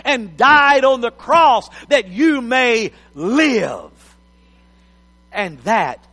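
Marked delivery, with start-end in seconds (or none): 0.1-2.1 loud, fervent preaching; booming motivational speech. Acted. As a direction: preach it out to the congregation. Preaching at full force.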